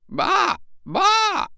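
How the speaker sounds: high imitated bleating; sheep bleat. Old sheep bleating as she walks out of the wood. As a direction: baa like an old ewe. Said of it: "she cried".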